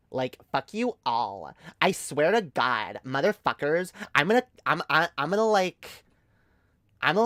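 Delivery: put-on sassy Valley Girl voice threatening the chat; bitchy Valley Girl. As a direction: play a bratty Valley Girl bragging about her tough boyfriend.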